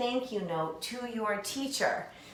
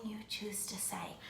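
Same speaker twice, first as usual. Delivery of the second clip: whisper; 16 dB quieter than usual. Acted. Teacher aside to children.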